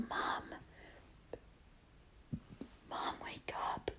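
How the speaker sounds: hushed, voiceless whisper; barely audible wake-up whisper; retold whisper. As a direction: whisper carefully to rouse someone asleep. Cousin quietly waking sleeping mother.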